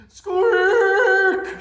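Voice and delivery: high, loud squeaky mouse alarm; loud squeaking mouse; mouse shout. Acted. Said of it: "shouts Mouse".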